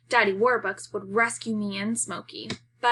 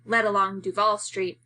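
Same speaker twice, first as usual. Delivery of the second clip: ordinary delivery, nothing marked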